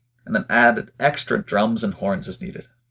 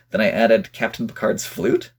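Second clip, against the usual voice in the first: ordinary talk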